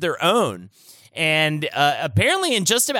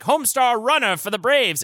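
high, hammed-up vintage radio announcer; old-timey radio announcer; 9 semitones higher than usual. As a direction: call it like an old ballgame radio host. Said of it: "old-timey radio impression of this guy".

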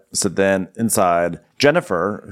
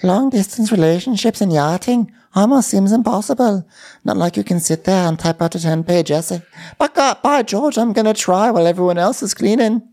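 high put-on voice, hamming up the self-pity; mock dramatic Natasha; 13 semitones higher, 3 dB louder than usual. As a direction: play it like an overwrought heroine. Mocking Natasha's dramatic text.

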